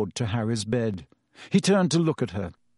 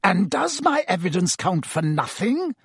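mild snarl, pitched up; 6 semitones higher than usual. A narrator's reading. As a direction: indignantly snarl a wounded challenge. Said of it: "snarled Snape".

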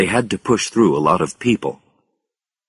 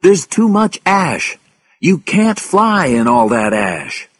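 slow, high warning call; drawn-out urgent warning; 9 semitones higher, 4 dB louder, slower than usual. A narrator's reading. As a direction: call after them, stretching the words. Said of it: "called Paul".

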